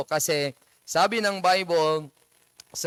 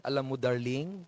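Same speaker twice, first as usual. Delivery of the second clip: softer, coaxing wife imitation; sweet-talking wife; 5 semitones lower, 5 dB quieter than usual. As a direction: coax the husband like a wheedling wife. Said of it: "madaming style ang wife na mapasunod". (malambing na asawang nanunuyo)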